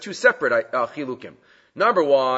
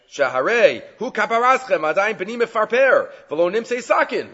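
lightly voiced father; 7 semitones higher than usual. Quoting the father's plea.

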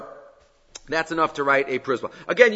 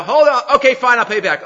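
high, loud, hurried surrender; mock-panicked debtor caving in; panicked giving in; 7 semitones higher, 6 dB louder than usual. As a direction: cry out as if dangling and desperate.

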